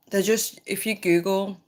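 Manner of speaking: talking through a burp. Real, not acted. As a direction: burp slipping out mid-word. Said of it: "sorry guys, I'm burping".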